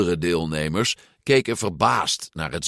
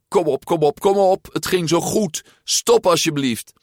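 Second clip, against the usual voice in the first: high, urgent begging; pleading; 10 semitones higher, 5 dB louder than usual. A narrator's reading. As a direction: beg desperately, loud and high. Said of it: "Luca smeekte de regen".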